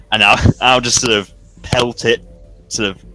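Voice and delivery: ordinary delivery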